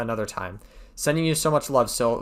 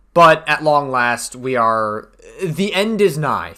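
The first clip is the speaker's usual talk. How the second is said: mock announcer; 7 dB louder than usual. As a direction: announce the grand finale like a showman. Mock grand announcement to viewers.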